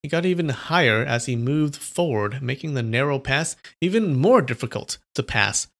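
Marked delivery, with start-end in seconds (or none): none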